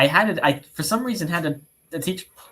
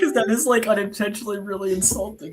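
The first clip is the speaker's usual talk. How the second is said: still laughing; 8 semitones higher, faster than usual. Real, not acted. Still laughing while explaining.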